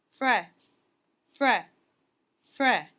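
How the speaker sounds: brisk, loud shaking-off sounds; shaking off water. A narrator's reading. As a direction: shake the fur dry with each fra. Coyote shakes off water.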